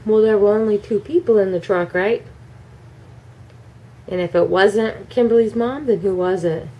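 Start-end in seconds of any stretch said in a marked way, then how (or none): none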